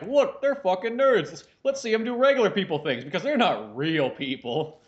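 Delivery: mocking sitcom audience. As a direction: sneer at the nerds like a dumb viewer. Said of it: "audience who just kinda likes to go".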